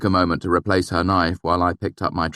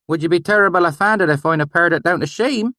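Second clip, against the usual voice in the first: valley dialect; 13 semitones higher, 6 dB louder than usual. A narrator's reading. Narrator faking the swineherd's dialect to gain trust, valley accent. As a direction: slip into the valley dialect to win him over. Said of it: "my sudden change in dialect".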